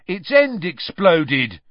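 loud, high, drawn-out anger; indignant and heated; angry. A narrator's reading. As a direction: complain angrily, stretching the words. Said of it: "said Dean angrily".

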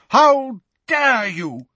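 slow, high, indignant cry; outraged shout. A narrator's reading. Teacher running to defend her colleague, outraged shout. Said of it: "the figure shouted as she ran".

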